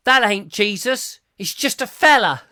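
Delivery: put-on loud, high-pitched Lancashire kid; Lancashire accent. Little nipper in the barn contradicting the others, loud Lancashire accent. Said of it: "with his Lancashire accent".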